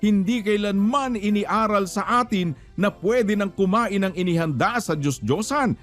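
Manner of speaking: ordinary talk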